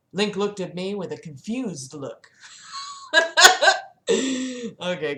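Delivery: ordinary delivery, nothing marked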